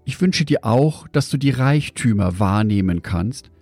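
ordinary talk, nothing marked

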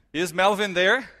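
provoking repeat call. Retold or imitated. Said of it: "Now let me show you what's anger".

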